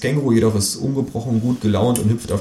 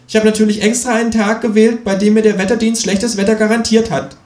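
high cheeky kangaroo; 12 semitones higher, 4 dB louder than usual. Acted. Kangaroo brushing off the complaining narrator, high and cheeky. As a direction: snap back high as the know-it-all kangaroo.

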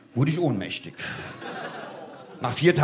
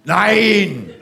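ordinary talk

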